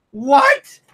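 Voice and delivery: high, sharp disbelief; incredulous what. Real, not acted. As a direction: jump up in pitch, baffled. Incredulous outburst.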